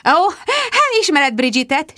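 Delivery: high, airy twitter; chirping. A narrator's reading. Rebecca gossips to Mark about Bridget, chirping. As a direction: chirp it with sugary lightness. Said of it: "csicsergett Rebecca".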